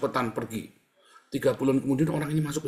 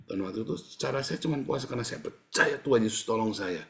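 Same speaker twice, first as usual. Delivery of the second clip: ordinary talk, nothing marked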